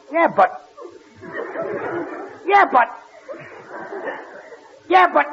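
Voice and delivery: high, cut-off protest, climbing each time; thwarted interjection. Acted. Man on phone cut off by the sponsor, flustered protest.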